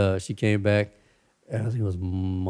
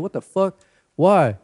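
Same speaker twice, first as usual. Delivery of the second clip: outraged disbelief; 9 semitones higher, 5 dB louder, slower than usual. Retold or imitated. Retelling Sarah's outrage at PayPal.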